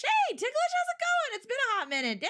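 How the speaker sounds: very high-pitched, delighted hello; excited greeting. Real, not acted. Streamer greeting a returning viewer, excited. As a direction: squeal hello to a long-missed regular.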